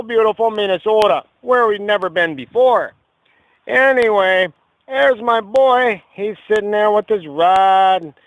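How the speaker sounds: loud, hearty fishing-show host; folksy TV host. Acted. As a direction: sell it like a local TV fishing host.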